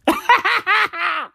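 short high giggle; a quick high chuckle; high laugh. Real, not acted. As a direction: laugh at your own line. Laughs at own joke.

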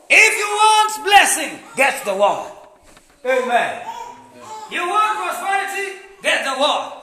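loud, high preaching in punchy call and refrain; forceful preaching. Real, not acted.

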